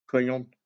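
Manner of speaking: Crayon said the English way as cray-on; French word. Shown as a demonstration. Teaching the French for pencil, a child repeats Crayon. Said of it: "how to say pencil in French is crayon".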